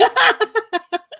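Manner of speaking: squealing laugh; high burst of laughter. Real, not acted. Host cracking up over the text, high squeal of laughter.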